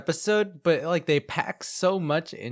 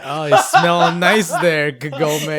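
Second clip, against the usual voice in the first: creepy flirt; 8 dB louder, slower than usual. Retold or imitated. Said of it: "he was such a creep".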